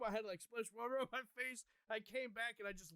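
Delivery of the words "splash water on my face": usual speaking voice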